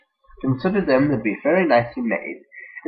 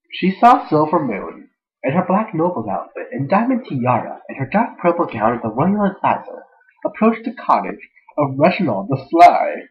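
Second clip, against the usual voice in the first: higher, swooping happy narration; super happy; 5 semitones higher, 5 dB louder than usual. Shown as a demonstration. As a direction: narrate with giddy, exaggerated cheer. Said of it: "I should be, like, a super happy voice".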